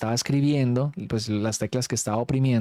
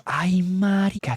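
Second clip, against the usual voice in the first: startled cry; 7 semitones higher, much slower than usual. Retold or imitated. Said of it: "Y nosotros".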